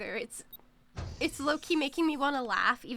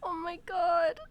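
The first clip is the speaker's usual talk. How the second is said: real tearful cry, a bit higher and louder; 4 semitones higher, 5 dB louder, slower than usual. Real, not acted. Streamer losing pet son in game, crying. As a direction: gasp it out through tears. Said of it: "I'm actually crying".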